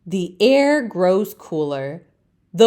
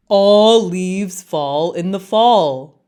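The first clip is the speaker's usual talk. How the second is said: slow, louder chorus of leaves; know-it-all leaves; 4 dB louder, slower than usual. Acted. Other leaves scold Leaf, slow and loud. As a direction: lecture it slowly like smug elders. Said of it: "the other leaves say".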